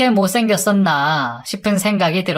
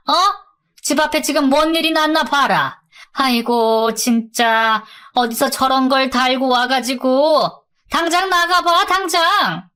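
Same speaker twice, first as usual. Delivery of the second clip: high-pitched nagging mom, rising to an order; scolding mother; 6 semitones higher than usual. A narrator's reading. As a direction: voice an exasperated mother chasing her daughter out. Mother scolds and throws daughter out. (높은 목소리로 야단치는 엄마)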